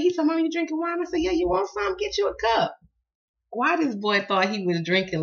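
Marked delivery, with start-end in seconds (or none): 0.2-1.0 put-on higher voice of a surprised boy; a lightly voiced boy; child's voice. Son asks mother in story. 1.2-2.8 high, playful offer; mock-serious mom offering a drink; teasing mom. Mother teasing son with juice.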